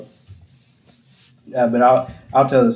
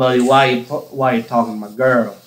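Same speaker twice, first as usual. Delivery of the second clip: put-on thick Southern accent; 3 semitones lower than usual. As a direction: imitate a country guy's thick Southern accent. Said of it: "really country guy with a thick Southern accent".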